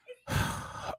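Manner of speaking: mock exasperated breath out; exasperated sigh. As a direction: let out a teasing, exasperated sigh. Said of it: "all of my exasperated breaths".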